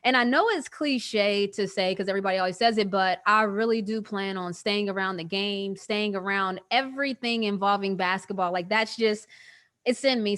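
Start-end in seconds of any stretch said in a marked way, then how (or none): none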